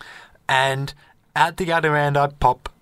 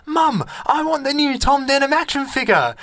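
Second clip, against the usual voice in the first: put-on much higher whiny child's plea; little kid; 12 semitones higher than usual. Child in a toy shop begging his mum, high kid voice. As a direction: pitch way up and beg like a kid. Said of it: "this kid rocks up and he goes".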